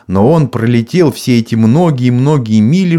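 ordinary delivery

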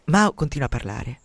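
ordinary delivery

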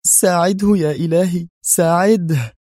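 high, anguished prayer; desperate high-pitched woman's plea; pleading cry. A narrator's reading. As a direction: cry out to God in fear for them. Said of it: "تهتف".

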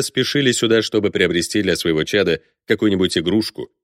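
ordinary delivery

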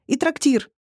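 high-voiced, eager afterthought; eager exclamation. A narrator's reading. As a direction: happily add it, pitched high. Alice adds a happy exclamation. (радостно, высоким голосом)

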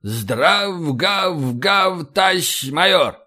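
mumbled barking chorus reply; garbled soldiers' chorus; ragged troops' reply. A narrator's reading. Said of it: "отвечает батарея".

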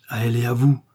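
eager, mildly exclaimed vow; fervent exclamation. A narrator's reading. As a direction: exclaim it with devotion. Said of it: "s'écria D'Artagnan". (exclamation fervente)

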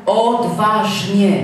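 "Odważnie" stretched long and loud; emphatic key word. Real, not acted. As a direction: drive "Odważnie" home, very slowly. Preacher drives the key word home.

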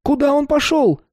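put-on high, anxious young man's voice; panicked young man. A narrator's reading. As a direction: ask in a panic.

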